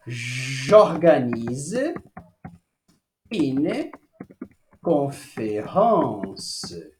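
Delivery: slow, low, carefully articulated French; modelled French sentence. Shown as a demonstration. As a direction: spell it out slowly in French. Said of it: "teacher models French phrase".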